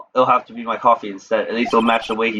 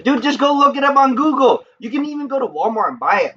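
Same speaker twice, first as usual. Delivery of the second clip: exasperated shout; 13 semitones higher than usual. Real, not acted. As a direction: yell at chat to just google it. Insisting to chat.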